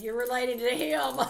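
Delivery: loud, high, laughing outburst; mock accusation shouted through laughter. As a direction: blurt it out while cracking up. Teases about relation, laughing.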